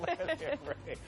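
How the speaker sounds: squeaky high repeat; high mock echo. Host echoes in a high voice.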